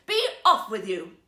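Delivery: loud angry shout. Acted. Emperor to the court ladies, furious shout. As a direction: drive them off with a furious yell. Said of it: "he shouted".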